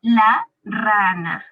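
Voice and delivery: teacher showing the article; slow demo. Shown as a demonstration. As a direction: lay out "la rana" slowly. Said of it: "Rana termina con la A".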